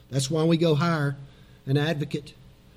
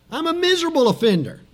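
mock confession; 10 semitones higher, faster than usual. Mock confession after the denial.

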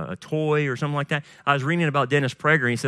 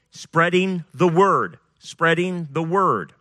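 "spreading the word" said slowly, each word weighted; emphatic repetition; 3 semitones higher, slower than usual. Shown as a demonstration. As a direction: stress "spreading the word" deliberately, slowly, both times. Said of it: "Notice the emphasis".

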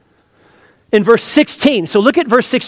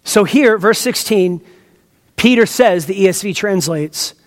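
usual speaking voice

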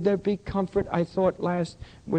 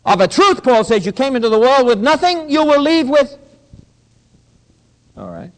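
thundering preaching; 7 semitones higher, 12 dB louder than usual. Real, not acted. Shouted preaching climax.

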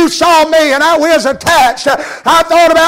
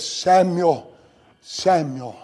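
a hushed, much softer "Samuel, Samuel"; soft call; 9 semitones lower, 10 dB quieter, much slower than usual. Shown as a demonstration. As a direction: call "Samuel, Samuel" gently, barely above a murmur. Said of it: "Not just Samuel".

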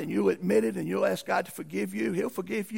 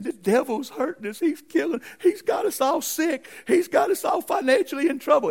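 high, loud put-on lament; mock complainers; 7 semitones higher, 6 dB louder than usual. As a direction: wail it like church folk blaming someone. Voicing complaining church people.

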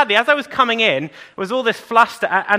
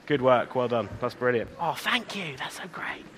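whispering; 7 semitones lower, 8 dB quieter than usual. Real, not acted. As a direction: whisper your thanks softly. Praising kids during quiet activity.

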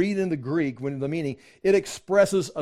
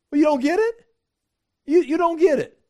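high-pitched indignant disbelief; acted exasperated rebuke, louder and higher; mock-incredulous scoff; 10 semitones higher, 5 dB louder, faster than usual. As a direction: pitch it way up as if astonished. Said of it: "he's snorting at them".

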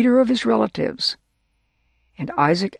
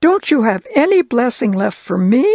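pleading; 5 semitones higher, 5 dB louder than usual. Acted. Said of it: "And Esau said to his father".

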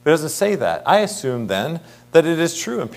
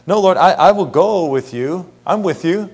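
lightly acted earnest protest, higher and louder; Peter; 6 semitones higher, 7 dB louder than usual. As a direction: insist loyally, voice lifted and forceful. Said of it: "That's why he says".

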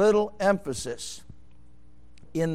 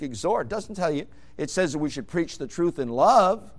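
normal talking voice

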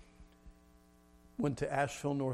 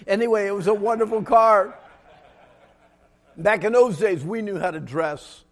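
loud, raised-pitch storytelling boast; big, loud preacher's voice; booming enthusiasm; 7 semitones higher, 17 dB louder than usual. Real, not acted. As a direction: belt it out to the whole congregation. Booming boast about old car.

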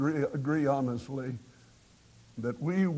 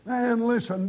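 ordinary delivery

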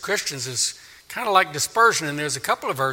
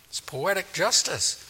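ordinary delivery

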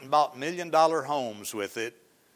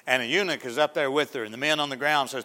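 normal talking voice

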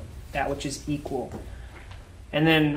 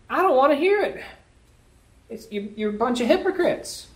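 fed-up Lord; 8 semitones higher than usual. Retold or imitated. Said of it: "and he says".

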